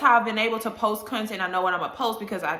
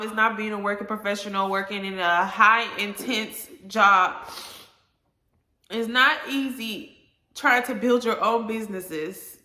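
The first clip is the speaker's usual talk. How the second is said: ordinary talk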